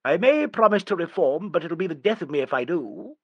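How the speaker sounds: light, carefree and irresponsible; gaily. A narrator's reading. Beddington to Nigel, making light of reform, gaily. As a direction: toss it off gaily, not a care. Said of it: "He said this quite gaily and irresponsibly".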